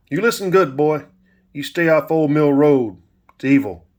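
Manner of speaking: loud, high-pitched preacher's warning, easing at the end. A narrator's reading. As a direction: thunder it like a preacher warning a child. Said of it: "put on his preacher's voice".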